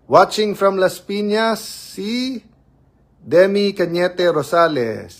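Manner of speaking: high, loud and slow announcing of a viewer; slow loud name call. Real, not acted. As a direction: announce the viewer like calling them up. (mabagal at malakas na pagtawag sa pangalan)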